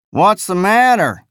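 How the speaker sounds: high, drawling simple-minded voice; drawly jejune voice. Acted. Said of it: "in his drawly jejune voice".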